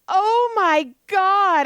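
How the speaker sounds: high, drawn-out mock dismay; retold dismay. Justine reacting to the news, in mock dismay. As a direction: stretch it out high, imitating a friend's shock.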